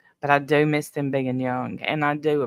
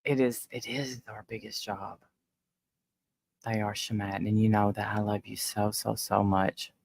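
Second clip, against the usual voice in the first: soft, low, real tearfulness; holding back tears, voice dropped low and quiet; tearful; 4 semitones lower, 7 dB quieter than usual. Real, not acted. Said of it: "I don't usually cry".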